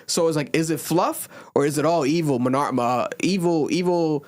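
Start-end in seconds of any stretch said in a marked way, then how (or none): none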